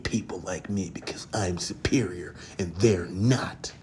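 mock fanboy drops to a whisper; smug whisper. Mock basement fan whispers his superiority, hushed. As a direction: whisper it as if sharing a secret. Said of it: "some dude who lives in his grandma's basement".

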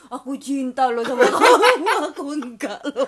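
loud, drawn-out child imitation, breaking into laughs; laughing mock kiddie voice; kid from a video. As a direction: mimic a little kid's love confession. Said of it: "sama yang video anak kecil itu".

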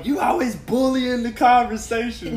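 put-on a squeaky take-off of his girlfriend; high mock partner voice. Boyfriend mimics girlfriend's complaint, high mocking voice. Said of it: "Then she go".

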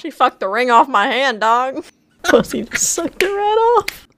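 high, loud, laughing retelling of a crude boast; hammed-up crude quote through laughter; laughing mock bravado. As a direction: crack yourself up quoting the line.

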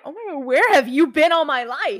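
loud, high gushing quote; retold delighted exclamation. Speaker retelling meeting friends with common interests, gushing. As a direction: gush as if finding a long-lost soulmate.

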